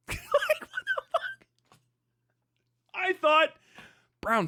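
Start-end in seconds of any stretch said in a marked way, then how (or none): 0.1-1.8 squeaky laugh through the words; high laughing what the fuck; laughing disbelief. Real, not acted. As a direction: crack up while saying it.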